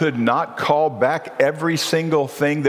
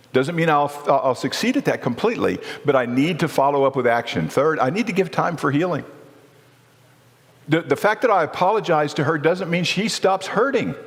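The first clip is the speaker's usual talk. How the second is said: normal talking voice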